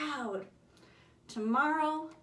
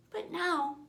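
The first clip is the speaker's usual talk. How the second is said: plain reading voice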